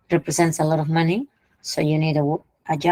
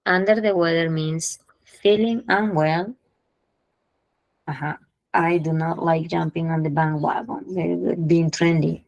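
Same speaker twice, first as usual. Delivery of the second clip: ordinary talk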